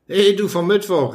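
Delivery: high-pitched urgent call. A narrator's reading. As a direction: call to him for attention. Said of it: "rief der im Skapander".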